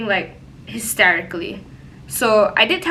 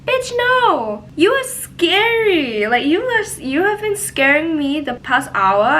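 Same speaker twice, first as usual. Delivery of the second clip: much higher, louder mock outrage; mock outraged reply; 8 semitones higher, 3 dB louder, slower than usual. Narrator snaps back at the drunk roommate, high outraged. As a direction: shriek it back in exaggerated disbelief.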